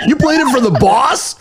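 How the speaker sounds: high-pitched, laughing disbelief; playful squeal of disbelief; mock incredulous. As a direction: echo it in amused, high-voiced astonishment. Host jokingly echoes the astonished question.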